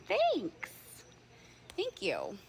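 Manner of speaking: high, squealed thank-you; excited thanks. Real, not acted. Reading a kind comment.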